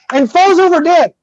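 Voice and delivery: ordinary delivery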